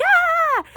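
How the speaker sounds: high, held "ahh" squeal; mock scream. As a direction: play-act a gang yelling as they run. Gang fleeing boat with money.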